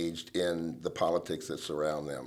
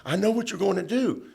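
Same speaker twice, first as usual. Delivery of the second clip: retold outburst, pitched way up; furious confrontation; 12 semitones higher than usual. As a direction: play the lawmaker going nuts in her face. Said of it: "he went nuts and ran across the Senate and got up in her face".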